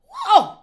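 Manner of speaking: high, loud whoop of joy; excited whoa. Real, not acted. Pastor whooping with joy mid-sermon. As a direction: let out a joyful whoop.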